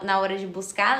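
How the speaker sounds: ordinary talk, nothing marked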